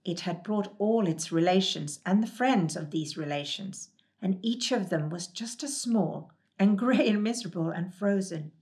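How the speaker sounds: usual speaking voice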